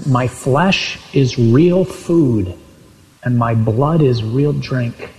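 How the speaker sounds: slow, deliberate reading of scripture; solemn scripture quote. Retold or imitated. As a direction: weigh each word slowly, as solemn teaching. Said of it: "And he says elsewhere in John six".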